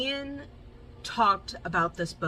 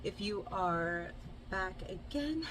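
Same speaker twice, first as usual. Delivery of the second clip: ordinary talk, nothing marked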